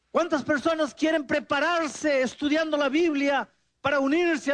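invitación agudo y fuerte; llamado de predicador. Retold or imitated. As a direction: clama como en el llamado. Imita el llamado del pastor. (predicador haciendo el llamado al altar)

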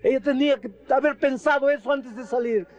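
high, sharp scornful retort; bitter husband. Retold or imitated. Husband rebuffing the pastor's plea, high and bitter. Husband rejects wife's return.

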